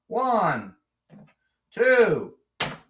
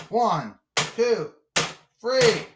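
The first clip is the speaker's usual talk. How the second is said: excited count; 6 semitones higher, much slower than usual. Pretend. Referee counts out the Miz, climbing excitement. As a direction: count rising higher each number.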